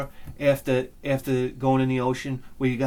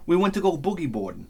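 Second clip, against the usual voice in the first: usual speaking voice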